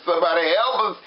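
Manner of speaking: high, play-acted cry for help; desperate plea. Wife beside dying husband begs for help, mock-desperate cry. Voicing woman pleading for help.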